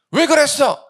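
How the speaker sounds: put-on sharp high shout at the child; startled scolding. Parent yelling at a child who threw a cup, loud and sharp. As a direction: yell why did you do that at the kid.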